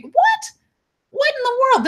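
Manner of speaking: squeaky high disbelieving "What?"; stunned disbelief; real shocked incredulity; incredulous. Real, not acted. Disbelief at the police search.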